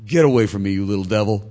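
loud, hammed-up scolding; mock subtle rebuke. Jesus correcting Peter, comically loud rebuke. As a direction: bellow it as a joke, anything but subtle. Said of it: "a little more subtle and said".

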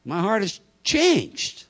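very high, loud comic whine; hammed-up squeal of a doubting churchgoer; doubting listener. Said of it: "what some of you are thinking right now".

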